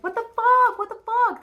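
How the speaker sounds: high, loud, panicked scream of the same question; screamy panic. Retold or imitated. Tarot reader voicing the Knight of Swords in panic, screaming. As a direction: shriek it out in shocked panic. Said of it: "screamy energy of the Knight of Swords being like".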